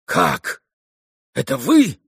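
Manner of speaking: mild cry of surprise; startled cry. A narrator's reading. Mitya making out the old woman in darkness, startled cry. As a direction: exclaim in startled recognition. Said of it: "вскричал Митя".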